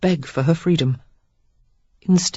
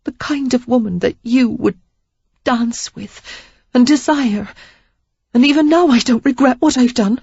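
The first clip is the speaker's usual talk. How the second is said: defiant woman; 8 semitones higher, 4 dB louder than usual. Acted. Sarah defiant confession continuing.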